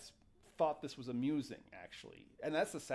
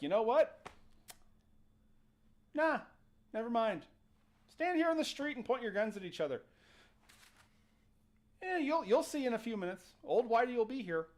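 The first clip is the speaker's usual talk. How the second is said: higher-pitched, quick exasperated brush-off; fed-up dismissal; 8 semitones higher, 4 dB louder, faster than usual. Retold or imitated. Town spokesman gives up on feuding soldiers, fed-up dismissal. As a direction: give up on them and let them find out.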